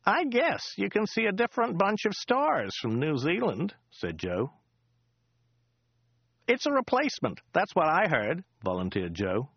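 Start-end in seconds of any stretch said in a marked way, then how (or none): 0.0-3.7 high, chatty local driver voice; a rambling cab driver, pitched up; chatty driver Joe. Acted. Driver Joe chatting to Dirk on the drive, high chatty voice. Joe's voice. 6.5-8.4 high, chatty local driver voice; a rambling cab driver, pitched up; chatty driver Joe. Acted. Driver Joe chatting to Dirk on the drive, high chatty voice. Joe's voice.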